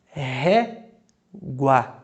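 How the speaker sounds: "régua" said syllable by syllable, the u light and the a open; slow syllable demo. Shown as a demonstration. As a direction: teach "régua" slowly, weak u and strong final a. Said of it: "Vocês viram como é que o som do é mais discreto?". ("régua" pronunciada devagar, u discreto e a marcante)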